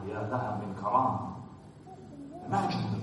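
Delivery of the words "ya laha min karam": normal talking voice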